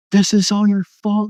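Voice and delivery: high, loud retold accusation; accusing outburst. Letting it all out alone at home, loud accusing.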